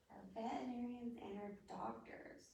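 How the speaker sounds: hushed whispered repeat; soft unvoiced aside. Shown as a demonstration. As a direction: whisper it like a secret to the class.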